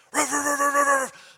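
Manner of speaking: high, loud yapping barks like a small dog; yappy little dog barks. Retold or imitated. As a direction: bark high and sharp like a tiny lapdog. Dogs rush at him at door.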